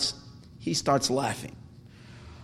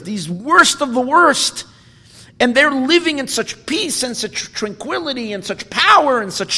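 higher and louder, a crying-out lament over the ruin; anguished lament; 8 semitones higher, 7 dB louder than usual. Acted. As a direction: plead it like mourners wailing at the destroyed temple. Rabbis weep over burnt temple.

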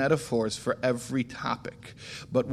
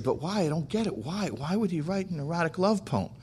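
higher, softer imitation of a puzzled woman; baffled questioning; 5 semitones higher, 5 dB quieter than usual. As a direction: press for an answer, not getting it. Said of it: "this lady looks at me like I fell off the moon".

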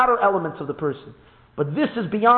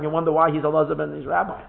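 ordinary talk

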